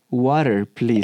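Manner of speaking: a lightly voiced kid saying Water, please in Spanish-accented English; child's shy English. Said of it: "Y se voltea con el profesor y le dice".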